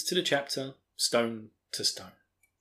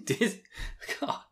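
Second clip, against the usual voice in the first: normal talking voice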